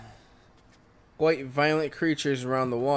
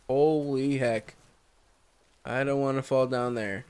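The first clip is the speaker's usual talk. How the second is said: usual speaking voice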